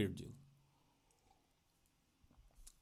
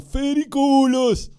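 high, loud, stretched-out commentator calls; hammed-up beach soccer commentary; sports commentator shout; 10 semitones higher, 8 dB louder, slower than usual. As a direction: belt out names like a goal call. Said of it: "Yo comenté Mundial de Fútbol Playa".

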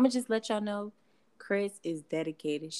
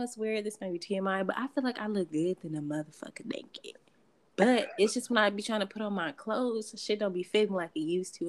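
usual speaking voice

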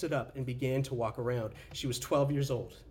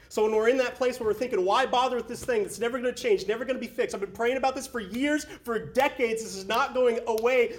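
much higher and louder, pleading; impassioned despair; 8 semitones higher, 5 dB louder than usual. Retold or imitated. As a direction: cry out the frustration. Voicing the despairing believer.

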